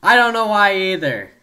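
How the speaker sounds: loud exasperated shout. Real, not acted. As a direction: throw up your hands and yell it. Shouted reply to friend.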